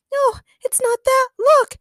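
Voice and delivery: very high, loud falsetto alarm; urgent high cry of warning; alarmed heroine. A narrator's reading. Daniela pointing Daniel to the monster, alarmed.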